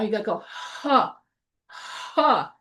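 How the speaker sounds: ha pushed out with a puff of air; breathy ha demo. Shown as a demonstration. Teacher coaching French student to aspirate ha. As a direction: fog the mirror with ha. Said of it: "how to say ha".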